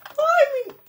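high-pitched loud "ay"; high yelp. Real, not acted. Vlogger opening a box on camera, high yelp. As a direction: let out a sudden high yelp. Startled cry while handling box.

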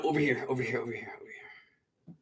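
hushed whisper to lure the snake. Real, not acted. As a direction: breathe it, don't voice it. Whispering while luring the snake.

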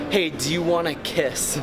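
normal talking voice

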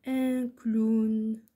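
slow, loud, stretched-out dictation. Shown as a demonstration. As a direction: project it slowly to pupils who are writing. Dictating words for pupils to write. (إملاء بطيء بصوت مرتفع)

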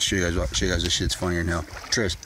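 usual speaking voice

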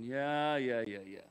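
low, dismissive yeahs; acted-out uninterested child; half-committed kid. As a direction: brush off a parent's directions. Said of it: "kids are only half committed".

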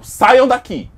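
loud commanding yell; loud order out. Acted. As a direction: throw them out loudly. Narrator ejecting the haters. (ordem de expulsão gritada)

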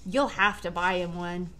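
plain reading voice

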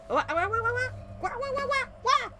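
high, rapid "wah-wah" yelps; panicked yelping. Real, not acted. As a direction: yelp repeatedly, pitch shooting up. Startled outburst during the game.

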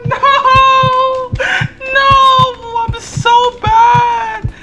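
very high, loud, drawn-out wails; anguished screaming. Real, not acted. Player botches the rescue, screaming in despair. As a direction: shriek in despair, breaking into laughter.

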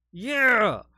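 loud, high drawn-out cheer; excited whoop. Real, not acted. Film fan celebrates the shark scene, excited whoop. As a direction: cheer it out at full voice.

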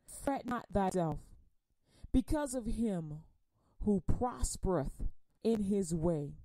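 solemn slow Psalm reading; slow scripture reading. Acted. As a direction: read each word slowly so it sinks in. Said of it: "I'm gonna read it slow".

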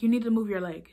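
mildly imitating a mean, yelling woman. Woman ordering an injured teen to move, mock yell. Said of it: "being really, really mean. Like, she was yelling".

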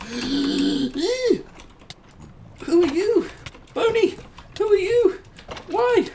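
high loud panicked questions; shocked shrieks. Real, not acted. Gamer shrieking high and loud at strange mobs appearing. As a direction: shriek in surprise.

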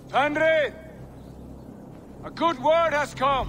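high, loud call across a distance; ringing shout to the great wolf. Acted.

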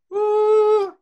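high head-voice uh demonstrated for a student. Shown as a demonstration. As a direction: float a high head-voice note. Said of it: "y cabeza es esto".

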